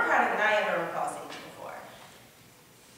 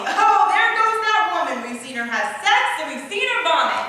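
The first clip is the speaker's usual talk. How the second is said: loud high gossiping neighbor voice; mocking gossip; 8 semitones higher, 10 dB louder than usual. Imagined neighbors gossiping about her, loud and hammed-up. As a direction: ham up the nosy neighbors. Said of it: "mutter under their breath".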